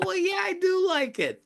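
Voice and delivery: very high, sheepish confession; high-pitched sheepish yes; sheepish admission. Retold or imitated. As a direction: own it in a high, bashful voice.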